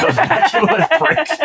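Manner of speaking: high-pitched laugh through the words; scoffing laugh on the words. Real, not acted. Laughing at the bachelor show.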